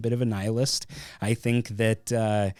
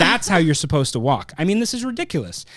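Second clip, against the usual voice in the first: exasperated director; 7 semitones higher, 5 dB louder than usual. Retold or imitated. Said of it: "He goes".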